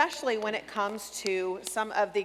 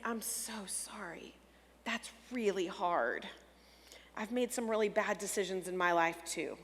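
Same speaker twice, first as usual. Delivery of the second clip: soothing parent; 3 semitones lower, 5 dB quieter than usual. Shown as a demonstration. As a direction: comfort a teen after a bad choice. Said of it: "meet them where they are and say".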